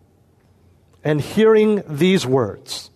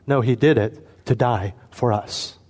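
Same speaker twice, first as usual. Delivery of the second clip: ordinary talk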